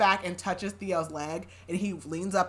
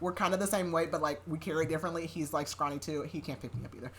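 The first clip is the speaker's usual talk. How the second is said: ordinary talk, nothing marked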